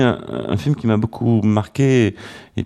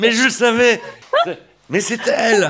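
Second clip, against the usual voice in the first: much higher, laughing as it clicks; delighted I-knew-it, laughing; excited realization; 13 semitones higher than usual. Real, not acted. Host delighted by the reveal.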